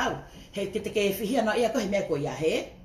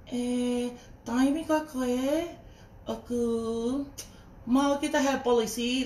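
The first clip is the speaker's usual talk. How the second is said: mocking her way of talking; 4 semitones higher, much slower than usual. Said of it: "the way she was talking".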